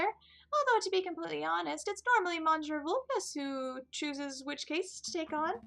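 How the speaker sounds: high, squeaky little mouse voice; a tiny mouse greeting customers; high-pitched Mousie. Acted. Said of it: "Who had a very high-pitched voice".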